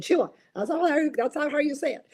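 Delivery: lightly acted, pointed correction; retold correction. Host quoting whoever corrected her macarons, mildly chiding. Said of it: "somebody finally corrected me and said".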